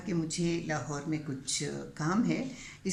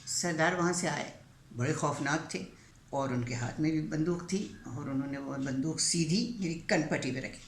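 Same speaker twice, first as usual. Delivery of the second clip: normal talking voice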